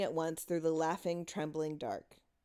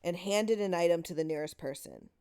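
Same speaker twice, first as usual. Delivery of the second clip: ordinary delivery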